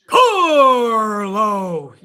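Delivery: loud, stretched announcer call of Carlo; ring announcer voice. Retold or imitated. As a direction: boom it like a wrestling announcer. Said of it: "And then we do".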